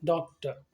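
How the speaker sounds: "डॉक्टर" ends on a short uh with no r; "डॉक्टर" with the final r left silent; silent r ending. Shown as a demonstration. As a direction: say "डॉक्टर" as doc-tuh, dropping the r. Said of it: "उसके बदले में produce किया sound अ".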